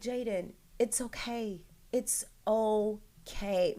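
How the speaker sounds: put-on calm an upset child, drawing the words out; soothing mother. Mother to crying son on the phone, slow and soothing.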